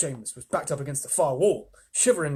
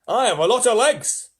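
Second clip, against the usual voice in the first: higher, louder boastful centipede; proud centipede; 8 semitones higher, 9 dB louder than usual. Acted. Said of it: "centipede answered proudly".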